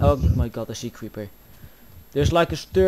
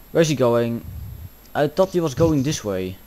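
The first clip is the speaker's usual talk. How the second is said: usual speaking voice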